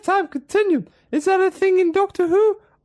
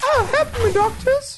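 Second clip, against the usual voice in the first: damsel in distress; 8 semitones higher than usual. Acted.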